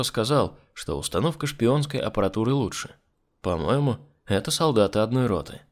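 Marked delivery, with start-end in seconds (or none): none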